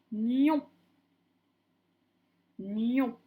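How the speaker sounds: loud, drawn-out dictated syllable; dictation voice. Shown as a demonstration. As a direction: dictate it firmly to a class, stretching the syllable. Teacher dictating syllables to pupils.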